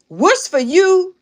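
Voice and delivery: WHAT'S FOR YOU drawn out, high and loud; emphatic lecturing. Retold or imitated. Aunt setting her niece straight, "what's for you" slow, high and emphatic.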